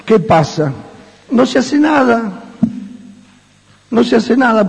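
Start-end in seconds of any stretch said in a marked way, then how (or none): 1.3-4.7 high indignant exclamation. Real, not acted. Preacher exclaiming the point.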